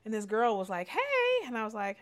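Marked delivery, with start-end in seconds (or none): 0.9-1.5 perky greeting. Retold or imitated. Bike club girl greeting.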